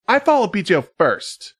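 put-on high girly sing-song; teen girl voice. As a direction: say it like a sixteen-year-old girl. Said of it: "like a sixteen-year-old girl".

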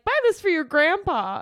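high, bright sales voice; mock ad pitch. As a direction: imitate a cheesy commercial.

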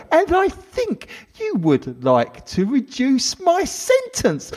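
high, smug crook voice; smug criminal voice. Acted. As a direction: gloat like a cocky villain. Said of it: "I don't have to do that voice every time".

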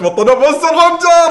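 high, rising retold cheer; re-enacted excited announcement. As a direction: act out announcing the winner, high-pitched.

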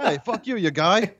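very high mock South African heckle; comic South African accent breaking into laughter. Co-host echoing the brawl line, high mock South African accent. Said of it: "in a South African accent".

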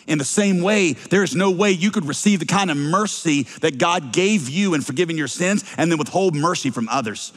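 ordinary talk

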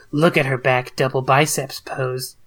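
usual speaking voice

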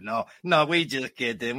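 usual speaking voice

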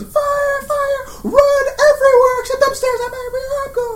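high, frantic fire yell; burning scream. Acted. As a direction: scream while shoving past. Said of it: "push her up, like".

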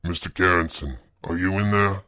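gruff man pressing for an answer. A narrator's reading. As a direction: demand it firmly in a gruff voice. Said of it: "more insistent".

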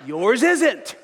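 usual speaking voice

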